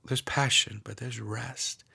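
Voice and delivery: hushed guided meditation, half whispered; guided prayer meditation. Acted. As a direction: lead them gently into prayer.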